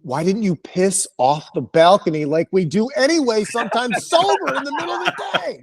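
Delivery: hammed-up exasperation climbing in pitch; exasperated scolding. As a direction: build to a high, incredulous squeal. Teller scolds friend in retold quarrel.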